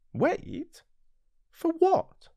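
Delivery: put-on high, light boyish voice, eager; a small prince asking his friend eagerly; little prince. Narrator voices the little prince, high and eager.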